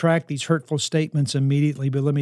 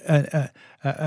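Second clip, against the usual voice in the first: ordinary delivery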